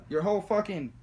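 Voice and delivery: usual speaking voice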